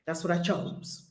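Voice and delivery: soft, low murmur to herself; half to herself, letting it sink in; low private murmur of her choice; quiet reminder. Real, not acted. Self-reminder repeated softly.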